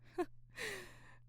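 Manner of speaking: bright airy laugh, high and soft; breathy little giggle; light laugh. Real, not acted. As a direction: let a soft amused laugh slip out. Amused reply to Oscar question.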